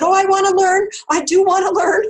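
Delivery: over-keen mock student pleading; eager. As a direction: insist you want it. Eager reply to healer.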